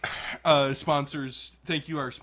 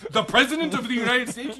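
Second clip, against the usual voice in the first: much higher and louder, laughing; shocked disbelief; 9 semitones higher, 7 dB louder than usual. Retold or imitated. Teen hears mom say Obama was killed, shocked. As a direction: exclaim in stunned disbelief. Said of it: "And I'm like".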